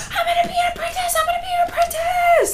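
very high, fast, squealing shout; little kit squealing with joy; excited kid shout. Acted. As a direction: bounce with glee and yell it. Kit excitedly announcing apprenticeship.

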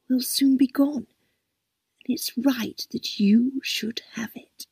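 put-on old lady thanking her loyal carer, hushed and sincere; earnest gratitude. A narrator's reading.

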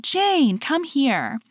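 retold yell. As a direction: call Jane in from the other room, up high. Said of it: "And so I yelled".

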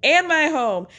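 high-pitched mock scream; retold screaming. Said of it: "Candace starts screaming".

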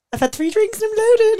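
high, loud imitation of a tipsy friend; mock tipsy friend. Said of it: "She's doing that, you know".

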